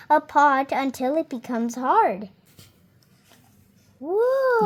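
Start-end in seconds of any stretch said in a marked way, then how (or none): 4.0-4.7 higher, drawn-out whoa of wonder; an open, impressed whoa; amazed whoa. Real, not acted. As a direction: marvel at the picture. Reacting to finished pot.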